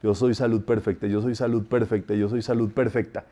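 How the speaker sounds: low, soft, even chanting repetition; repeated decree. Shown as a demonstration. Teacher showing decrees on the way to work, low repeated murmur. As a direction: murmur it round like counting beads. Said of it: "con tu heptario te pones tú".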